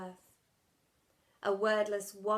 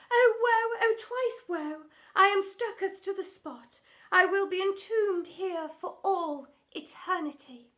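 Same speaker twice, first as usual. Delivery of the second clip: high, loud, overwrought stage voice; hammed-up old-English drama queen; theatrical Shakespearean lament; 8 semitones higher, 9 dB louder than usual. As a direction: wail it like a tragic heroine on stage.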